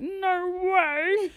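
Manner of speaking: high, drawn-out mock astonishment; re-enacted high-pitched amazement; amazed disbelief. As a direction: act out delighted disbelief. Acts out own amazed reaction.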